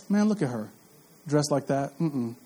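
low, hushed, disapproving judgment; judgmental gossip. Retold or imitated. Preacher mocks Christians judging a woman's dress, hushed and disapproving. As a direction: sneer under your breath at her outfit. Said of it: "we're like".

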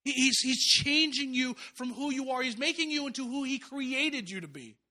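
impassioned preaching. Acted. Preacher climaxes on surgery image.

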